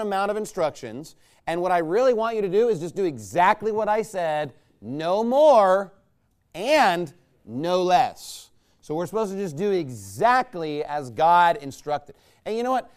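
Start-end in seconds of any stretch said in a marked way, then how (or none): 4.8-8.5 no more punched louder, then and no less drawn out very slowly; emphatic. Real, not acted. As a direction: hammer home "no more" and stretch "and no less". Preacher stressing exact obedience.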